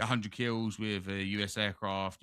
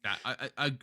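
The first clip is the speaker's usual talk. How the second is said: usual speaking voice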